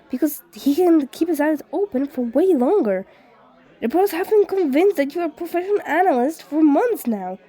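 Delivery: put-on friendly ghost boy gushing with praise; eager ghost friend. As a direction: rave at him like a starstruck fan. Voices Shirakumo at length.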